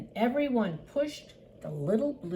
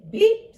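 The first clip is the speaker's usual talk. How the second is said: high, loud honk from a little truck; bright pop of a horn, voiced high; little truck beep; 13 semitones higher, 14 dB louder than usual. A narrator's reading. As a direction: toot a short, high beep. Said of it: "said the friendly little blue truck".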